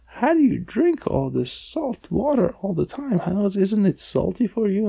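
lightly voiced shrimp, high questioning tune; puzzled little shrimp. Tiny questions saltwater shrimps, lightly acted high puzzled voice.

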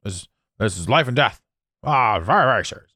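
put-on grave self-importance; mock very serious. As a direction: act as if it's gravely serious. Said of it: "make sure that everything is very serious".

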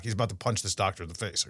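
normal talking voice

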